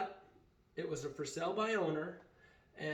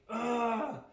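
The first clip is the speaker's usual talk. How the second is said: exasperated groan; 7 semitones higher, 4 dB louder than usual. Retold or imitated. Dad refuses, exasperated.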